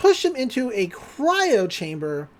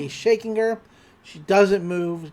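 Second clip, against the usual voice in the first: usual speaking voice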